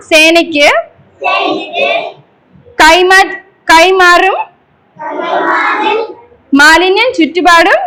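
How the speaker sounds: slow, projected recitation in short phrases; leading a pledge. Shown as a demonstration. Teacher leading children in an environment pledge, slow measured call-out. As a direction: call out each phrase firmly, pausing for the echo.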